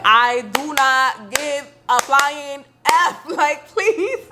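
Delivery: high, slow and scornful, with a laugh; dismissive. Retold or imitated. To friends who keep fussing at her, mock dismissal. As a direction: brush them off as if they're not worth it. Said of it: "I'm like".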